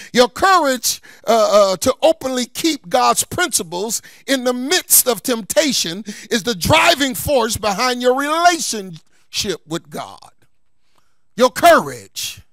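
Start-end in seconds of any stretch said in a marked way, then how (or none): none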